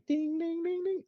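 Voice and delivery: high, sing-song ding on one held note; imitated alert chime. Shown as a demonstration. Presenter shows viewers the daily alert, high imitated chime. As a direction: mimic a notification bell in a high voice. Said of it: "And it'll sound, you know, kinda funny".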